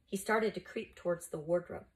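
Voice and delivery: ordinary delivery, nothing marked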